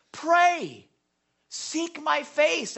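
high, very slow, pressing each word; emphatic command. Shown as a demonstration. Pastor stressing the action to his congregation, high and slow. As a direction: lift "Pray" high, then slowly stretch "Seek my face". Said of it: "Here's the action".

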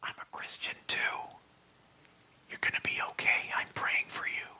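soft unvoiced whisper, secret comfort; retold whisper. Hospital worker secretly comforting a patient, whispering. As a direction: whisper it so no one else hears. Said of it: "He, he whispers to me".

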